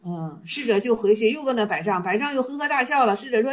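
ordinary talk, nothing marked

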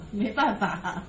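ordinary talk

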